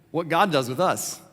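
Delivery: ordinary talk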